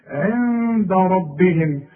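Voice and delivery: عند ربهم slow, the ن hidden into د with nasal hum; slow nasal hiding. Shown as a demonstration. Teacher demonstrating ikhfa. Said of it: "من حروف الإخفاء".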